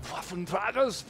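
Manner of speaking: "Waffenträgers" boomed with mock-German gusto; "Waffenträgers" as a high, showy exclamation; emphatic. Shown as a demonstration. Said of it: "say it with that kind of emphasis".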